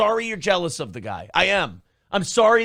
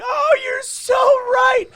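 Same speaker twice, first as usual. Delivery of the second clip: much higher, slow, loud squeal; mock gushing voice; 6 dB louder, much slower than usual. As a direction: gush slowly like a swooning fan. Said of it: "She's like".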